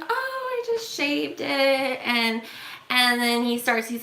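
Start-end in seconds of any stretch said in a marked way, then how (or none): 0.0-1.5 high, softer breezy brush-off; airy reply. Retold or imitated. Woman answering the stranger's cancer question, airy mock politeness. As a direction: toss it off lightly. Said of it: "And I'm like".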